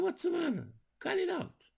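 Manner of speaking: sharp high call to break it up; calling out to stop. Retold or imitated. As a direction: yank them apart with your voice. Breaking up a fight between boys.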